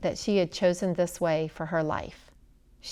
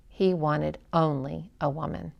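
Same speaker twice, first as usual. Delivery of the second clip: plain reading voice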